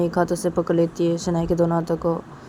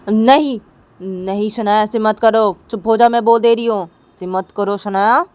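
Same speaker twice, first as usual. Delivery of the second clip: alarmed angry protest; 4 semitones higher, 6 dB louder than usual. Retold or imitated. Tisha stopping Shanaya from calling, loud protest. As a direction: stop her forcefully. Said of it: "Tisha बोलती कि क्या".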